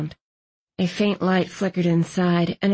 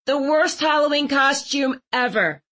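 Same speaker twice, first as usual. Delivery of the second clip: mildly bitter, higher voice; understated bitter complaint, raised pitch; 7 semitones higher than usual. A narrator's reading. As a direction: grumble with a touch of bitterness. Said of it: "Thistle said bitterly".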